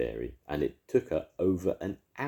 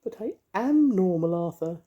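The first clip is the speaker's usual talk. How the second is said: very high, louder fairy voice; comforting fairy; 7 dB louder than usual. A narrator's reading. Mavis comforting the ice lolly in a high fairy voice. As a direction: soothe him in a tiny high fairy voice.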